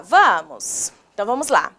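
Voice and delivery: ordinary talk, nothing marked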